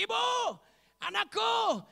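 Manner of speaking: high, loud acted call. Jesus calling to the frightened woman, loud high call. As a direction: call out in short separate bursts.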